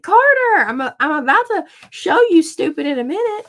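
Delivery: high, loud exasperated mom voice; mock scolding threat. Mother retelling warning to mouthy son, exasperated. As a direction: re-enact a mother warning her kid, loudly.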